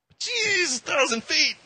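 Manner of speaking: squeaky, very high mock alarm; mock panicked balk. Believer staring down a thousand-foot cliff, squeaky mock alarm. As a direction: yelp it like the drop just hit you.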